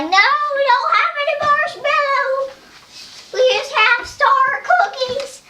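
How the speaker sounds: high playful toy voice; squeaky play voice. Pretend. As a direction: chirp the answer like a little toy. Toy dialogue continues.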